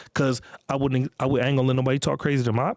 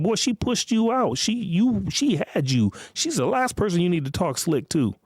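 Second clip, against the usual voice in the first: much higher, heated dad scolding his son; scolding father; 6 semitones higher than usual. Retold or imitated. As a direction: tell the boy off, voice rising. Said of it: "That's what I would say".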